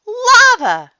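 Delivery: very high, loud excited shout; yell. A narrator's reading. Froggy spotting lava in the volcano, excited yell. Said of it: "yelled Froggy".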